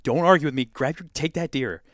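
urgent command. Retold or imitated. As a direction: push your buddy to shoot now. Urging buddy to shoot.

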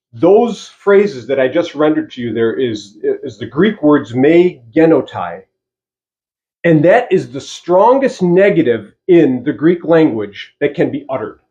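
normal talking voice

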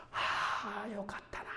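soft, high sigh of fond memory; contented sigh. Retold or imitated. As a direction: breathe out a happy memory. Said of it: "思い出して". (昔を懐かしむ満足のため息)

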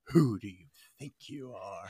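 low, soft haughty put-down; a lightly voiced snooty Chicago lady; Mary Sunshine voice. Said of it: "or Mary Sunshine from Chicago".

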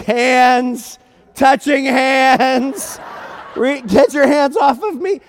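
loud, high, drawn-out mock alarm; alarmed outcry. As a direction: cry out loud and high, stretching the words. Retold alarm at being touched.

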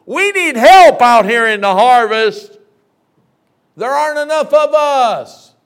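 slow, loud high plea; preacher modeling an urgent plea; crying out in prayer. Acted. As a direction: cry out to heaven for help. Prayer cried out in sermon.

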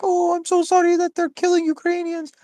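high, sarcastic fake-sorry voice; sneering fake concern; mock sympathy. Mocking quote of fake sympathy.

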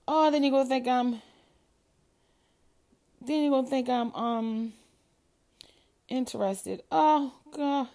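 loud panicked rush; real panic. Real, not acted. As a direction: panic over the tapped story. Panicked worry continues.

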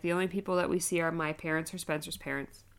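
ordinary talk, nothing marked

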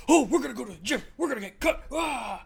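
loud, high mock jock; hammed-up athletic hype; aggressive gym-bro imitation. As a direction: shout like a pumped-up gym bro. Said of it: "You're, like, aggressively athletic".

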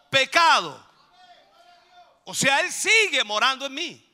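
loud pulpit declaration; preaching at full force. Acted. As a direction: keep the fire going.

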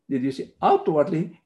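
ordinary talk, nothing marked

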